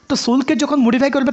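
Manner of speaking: ordinary delivery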